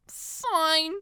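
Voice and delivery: put-on high, whiny girl voice; whining. A narrator's reading. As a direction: whine it out in a high pitch. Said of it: "she whines out".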